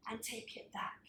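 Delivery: breathy half-whisper. Shown as a demonstration. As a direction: whisper the cue under effort. Exercise cue, whispered during effort.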